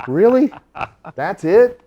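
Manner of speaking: high, loud, laughing disbelief; relieved surprise. Retold or imitated. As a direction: relief bursting out. Relieved incredulous reaction.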